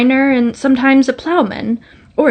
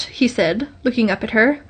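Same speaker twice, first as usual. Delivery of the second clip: ordinary delivery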